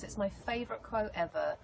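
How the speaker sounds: usual speaking voice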